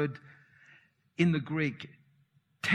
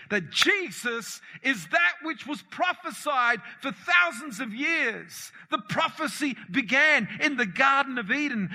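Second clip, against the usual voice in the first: raised, ringing preacher's voice, pushing each line; full-force preaching; 5 semitones higher than usual. Acted. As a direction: preach it out to the congregation. Preaching build-up to Eden prophecy.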